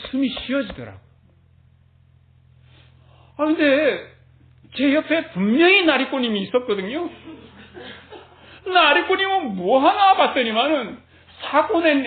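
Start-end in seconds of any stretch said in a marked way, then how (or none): none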